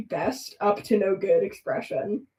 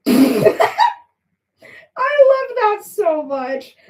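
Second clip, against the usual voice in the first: much higher and louder, slow stretched love; delighted; 12 semitones higher, 8 dB louder, much slower than usual. Real, not acted. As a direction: gush with giddy delight.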